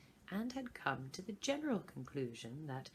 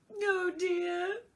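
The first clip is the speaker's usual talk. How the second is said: sudden crying wail, much higher and louder; a child bursting into tears, much higher; 14 semitones higher, 11 dB louder than usual. A narrator's reading. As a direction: break into sobs on it. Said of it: "with a sudden burst of tears".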